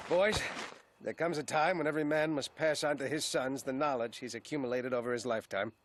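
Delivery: low, grave farewell address; solemn fatherly speech. Acted. Father passing knowledge to sons before exile, solemn. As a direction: hand down wisdom gravely, pitched low.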